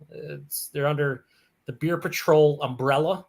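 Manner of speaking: ordinary talk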